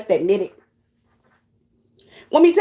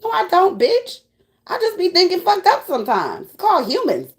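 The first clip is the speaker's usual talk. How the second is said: normal talking voice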